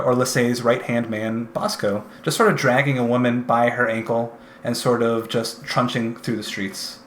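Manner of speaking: normal talking voice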